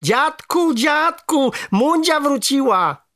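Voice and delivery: high, drawn-out excited shout; joyful calling. A narrator's reading. Boy tells grandfather the frog is back, shouting joyfully. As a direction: call out with delight, stretching it. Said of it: "zawołałem i z radości".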